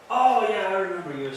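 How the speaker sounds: retold burst of recognition, easing off; sudden recognition. Agitated man remembers the officer, sudden loud recognition.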